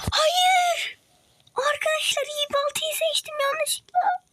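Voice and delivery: very high, slow squeaky cartoon voice; squeaky dismay. Real, not acted. Wrong axe chosen, dismay. (çok ince, yavaş çizgi film sesiyle)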